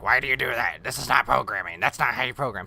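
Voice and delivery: a hint of a snooty commenter; mocking critics. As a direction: mimic the nitpickers. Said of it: "They're like".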